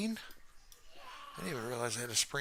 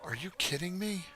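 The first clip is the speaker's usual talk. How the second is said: startled disbelief; 8 semitones higher than usual. Real, not acted. Startled by mannequin.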